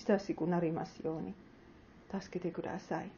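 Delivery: soft, hushed prayer to Jesus; closing prayer. Acted. Pastor prays for the congregation at sermon's end, soft hushed prayer. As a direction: pray gently, fading to near silence.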